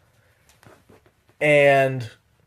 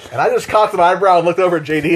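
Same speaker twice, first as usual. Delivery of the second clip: ordinary talk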